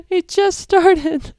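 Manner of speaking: high, breaking voice, close to sobbing; crying. Real, not acted. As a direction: cry it out in disbelief. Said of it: "I'm gonna start crying again".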